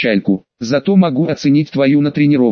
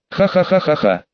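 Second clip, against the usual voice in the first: slightly mad laugh; 3 semitones higher than usual. Acted. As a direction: laugh a little madly. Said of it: "Мой слегка безумный смех".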